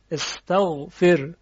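استغفر drawn out slowly, syllable by syllable; slow demo. Shown as a demonstration. Teacher to students, showing how استغفر sounds, slowly. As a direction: spell out استغفر slowly so each sound is heard. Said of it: "همچنین در استغفر".

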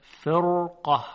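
slow فرقه with a heavy R; heavy tafkhim R. Shown as a demonstration. Teacher shows the tafkhim rule to learners, heavy R in فرقه. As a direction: draw out فرقه, thickening the ر before the ق.

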